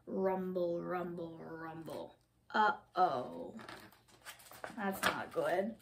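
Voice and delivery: low, soft rumbling, fading away; ominous hush. A narrator's reading. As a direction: drop low and quiet for trouble coming. Storm cloud rolls in, ominous.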